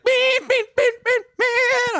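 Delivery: high, mock electronic beeps sung on one note; imitated beeping. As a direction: chirp the beeps like a machine. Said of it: "and it's like".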